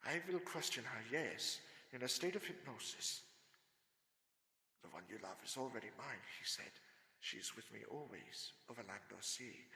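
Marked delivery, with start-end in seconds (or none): 4.8-9.6 hushed recitation. Acted. Reciting Dracula's words, hushed.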